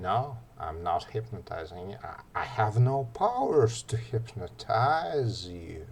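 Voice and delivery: ordinary talk, nothing marked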